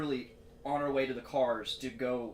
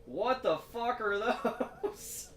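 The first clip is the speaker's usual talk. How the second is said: laughing mock disbelief; 11 semitones higher, much slower than usual. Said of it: "And I was like".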